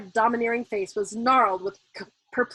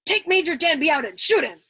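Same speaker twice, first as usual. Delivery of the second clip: loud order; 6 semitones higher, 7 dB louder than usual. A narrator's reading. Colonel passing on order.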